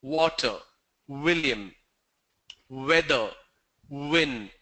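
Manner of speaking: W said with lips rounded into an O; rounded W demo. Shown as a demonstration. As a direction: round the lips on each W word. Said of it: "make a O with your W".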